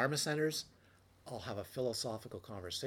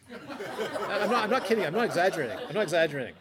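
louder, higher, laughing through the words; real laughter, louder and higher, swearing it's no exaggeration; 8 semitones higher, 12 dB louder than usual. Real, not acted. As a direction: laugh out loud while insisting it's true. Laughing at his own story.

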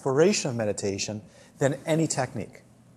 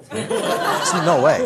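higher, louder, drawn out through laughter; laughing; 6 semitones higher, 7 dB louder, much slower than usual. Real, not acted. Teacher telling students why he refused her, laughing. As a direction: say it laughing, stretched out slowly.

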